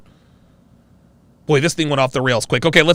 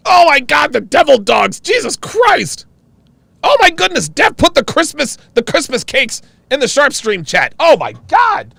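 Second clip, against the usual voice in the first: excited shouting; 8 semitones higher than usual. Real, not acted. Streamer yelling about devil dogs to chat. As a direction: yell with sugar-rush glee.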